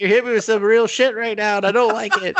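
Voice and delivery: high, fast choked-up voice; mock emotional. Guy touched by a real moment, high mock whimper. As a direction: gush it out high and quick, half laughing. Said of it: "He's like".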